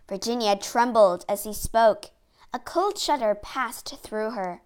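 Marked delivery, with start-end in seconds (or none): none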